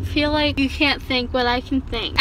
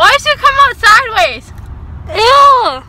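excited; 4 semitones higher, 6 dB louder than usual. Real, not acted. Drugged loud outbursts.

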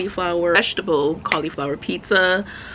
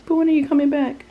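higher, fast whiny plea; mock pleading; 8 semitones higher, much faster than usual. Mom begging her daughter leaving for school, playful whine. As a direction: beg like a clingy mom.

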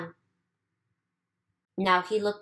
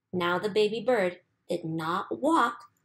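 ordinary delivery

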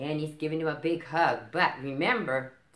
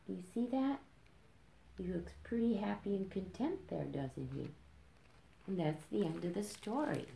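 hushed; 8 dB quieter than usual. Real, not acted. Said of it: "reader whispers after bedtime".

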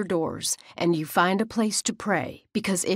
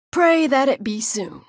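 higher, louder grim warning; ominous warning; 6 semitones higher, 5 dB louder than usual. Acted. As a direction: warn her with dark force.

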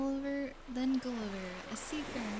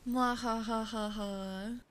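slow evil cackle; slow villain laugh; mad scientist cackle; slower than usual. Pretend. Evil laugh after deadly.

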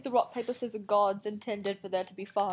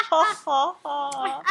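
mock laugh; 3 semitones higher, 6 dB louder, slower than usual.

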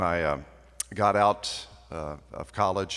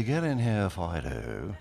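genteel dog call; 2 semitones lower, slower than usual. Retold or imitated. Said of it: "when my sister goes to the back door".

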